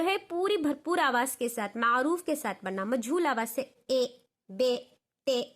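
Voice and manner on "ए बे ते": ए, बे, ते each with an ay sound, not ee; wrong ay vowel. Shown as a demonstration. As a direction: demo the mistake ए, बे, ते with ay. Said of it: "इस तरह नहीं पढ़ना अरबी में".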